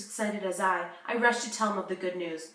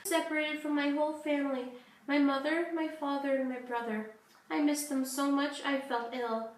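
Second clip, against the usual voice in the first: put-on higher, childlike voice, sad and homesick; little girl voice; 5 semitones higher than usual. Little Sarah tells the host how she escaped, childlike and sad.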